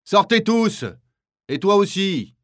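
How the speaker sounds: high, loud shouted commands; yelled orders. Acted. Kapo ordering prisoners outside, shouting. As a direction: shout them out of the block. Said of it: "hurle le capot".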